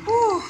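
high breathy whew of effort; relieved whew. Real, not acted. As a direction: blow out a worn-out whew. Exhale after climbing stairs.

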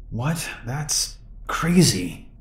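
slow, soft, groggy mumble; barely awake, drowsy and disbelieving; half asleep. Retold or imitated. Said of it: "still half asleep".

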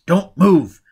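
sudden loud, high-pitched order; abstracted command. A narrator's reading. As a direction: call out sharply to freeze them. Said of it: "Baker said in an abstracted voice".

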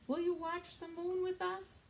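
owl's invitation. Acted. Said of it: "called Owl".

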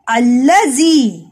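अल्लाजी slowly, with a long held ल; slow doubled l. Shown as a demonstration. Teacher slowly modelling अल्लाजी for children. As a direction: stretch out अल्लाजी, leaning on the double l. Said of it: "इसमें दो दफा लाम इस्तेमाल हुआ है".

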